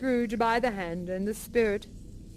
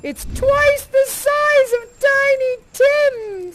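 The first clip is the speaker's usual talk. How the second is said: gleeful scheming; 5 semitones higher, 6 dB louder than usual. Acted. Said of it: "says Scrooge".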